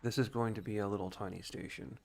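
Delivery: ordinary talk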